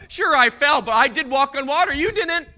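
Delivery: loud, smug bragging; boastful. Acted. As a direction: rub it in to the others. Peter boasting to others.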